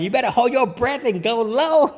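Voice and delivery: high, laughing party call; laughing MC tease. Retold or imitated. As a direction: tease the crowd through a laugh. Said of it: "You tell 'em".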